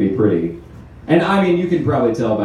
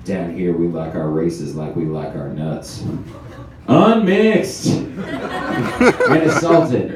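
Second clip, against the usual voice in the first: hillbilly drawl; 5 semitones lower, much slower than usual. Retold or imitated. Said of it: "look down the camera and say".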